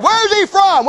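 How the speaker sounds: high-pitched, pouncing question; eager questioning. Retold or imitated. Pilate looking for a way out, eager questioning. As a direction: ask it as if spotting an escape.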